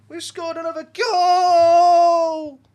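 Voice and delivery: goal celebration shout. Real, not acted. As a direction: roar it out as the team scores, slowly. Football game goal while holding dog.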